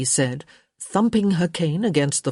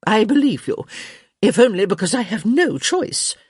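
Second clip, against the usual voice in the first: higher, theatrical concession; show of acceptance; 6 semitones higher, 4 dB louder than usual. A narrator's reading. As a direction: concede with grand reluctance. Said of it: "with a great show of acceptance".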